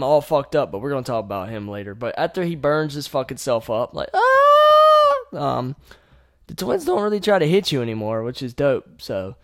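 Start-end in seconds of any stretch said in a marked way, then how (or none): 4.1-5.4 very high, loud hammed-up scream; mock scream. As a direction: let out a shrill fake shriek of pain. Father Martin burning himself.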